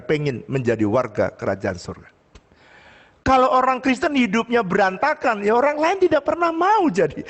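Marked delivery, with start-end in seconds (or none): 3.2-7.2 raised, high-pitched sermon voice; impassioned preaching. Real, not acted. Pastor urges congregation toward a different way of life, impassioned preaching. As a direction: drive the message home to the congregation.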